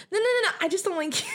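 high, emphatic comeback; high retort shutting her down. Retold or imitated. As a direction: snap back a sharp, high no. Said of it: "retold comeback".

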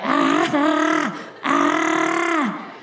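high strained gasps of pain; imitated cries of pain; mock pained cries. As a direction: gasp out short pained ahs. In pain during procedure.

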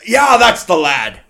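loud, hearty dwarf rambling; jolly dwarf. Acted. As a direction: play the cheery old dwarf chatting. Dwarf gossiping.

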